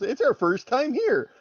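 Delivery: high, loud self-mocking repeat; embarrassed realization. Player repeating the character's silly apology, mock incredulous. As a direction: blurt it back, hearing how silly it sounds. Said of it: "He realizes how stupid that sentence was".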